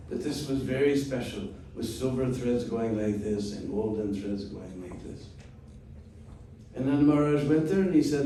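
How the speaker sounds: ordinary talk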